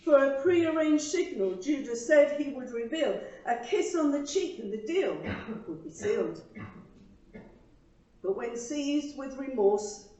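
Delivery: earnest, measured poem reading with pauses; poem recital. Acted. Reading her poem to the congregation, earnest recital. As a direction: recite it like a heartfelt church poem.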